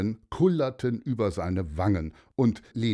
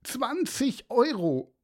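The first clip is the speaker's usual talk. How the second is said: put-on wailing boy; 14 semitones higher than usual. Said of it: "schluchzte Aaron".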